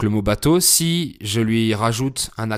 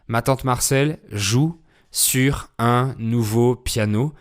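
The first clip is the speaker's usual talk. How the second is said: slow mnemonic phrase; slower than usual. Shown as a demonstration.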